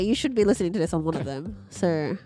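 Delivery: usual speaking voice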